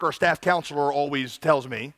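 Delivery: usual speaking voice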